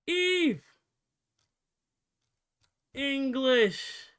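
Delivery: loud, high-pitched call. Real, not acted. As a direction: shout it out as if summoning someone. Calling out for the missing voice.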